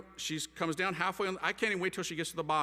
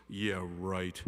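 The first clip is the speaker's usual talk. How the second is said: low, deadpan mom brush-off; dismissive disbelief; 9 semitones lower, 4 dB quieter than usual. Retold or imitated. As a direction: imitate an unimpressed mother, low and dry. Mother dismisses his confession.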